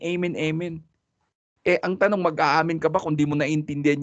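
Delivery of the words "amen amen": mock congregation amen. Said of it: "lagi silang nag-a-amen".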